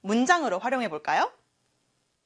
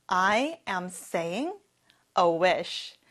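slow whole sentence; 3 semitones lower, much slower than usual. Shown as a demonstration. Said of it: "같이 해볼까요?".

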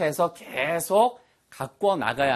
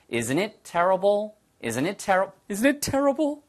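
slow, rising "Isn't it terrible?"; slow model line; 4 semitones higher, much slower than usual. Shown as a demonstration. Teacher demonstrates the question slowly for students. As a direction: stretch each word for learners. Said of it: "이런 얘기죠".

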